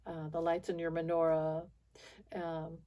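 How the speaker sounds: ordinary talk, nothing marked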